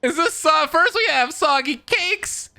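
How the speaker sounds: high-pitched laughter spilling into the words; laughing disbelief. Real, not acted. Streamer mocking the game's soggy pizza, high laughing disbelief. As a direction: crack up while asking what just happened.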